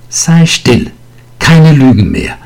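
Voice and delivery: laut und hoch angefaucht; fauchend. A narrator's reading. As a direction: schneid ihm scharf das Wort ab. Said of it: "fauchte sie ihn an". (die Lehrerin faucht Vinzenz wütend an)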